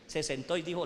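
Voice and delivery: ordinary delivery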